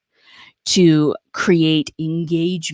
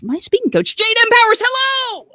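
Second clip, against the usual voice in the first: excited; 4 dB louder than usual. Real, not acted. Excited host introduces her coach.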